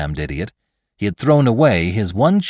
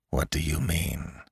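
very soft, intense question; hushed cry; 3 semitones lower, 10 dB quieter than usual. A narrator's reading. Attacker questions the man he is choking, hushed intensity. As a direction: demand it under your breath. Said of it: "cried Lantry".

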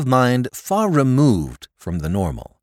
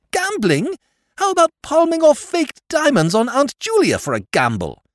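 high, loud incredulous jab; scornful retort; 4 dB louder than usual. Acted. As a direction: turn the accusation round on him. Nephew retorting to uncle.

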